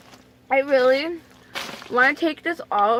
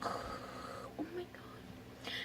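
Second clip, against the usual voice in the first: whispered slowly, almost no voice; dreading whisper; 3 semitones higher, 22 dB quieter, much slower than usual. Retold or imitated. Reliving her own dread at the needle, slow whisper. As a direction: breathe it out in dread. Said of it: "And I'm like".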